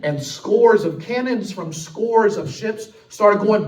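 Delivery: loud, high, driving sermon delivery; preaching at full force. Acted. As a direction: preach it hard and build the fire. Preacher retells battle at full force.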